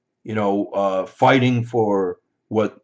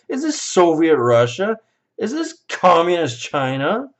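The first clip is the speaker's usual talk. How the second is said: high-pitched, indignant challenge; outraged rant; 6 semitones higher than usual. Real, not acted. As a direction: demand it in mounting disbelief. Ranting at would-be thought police.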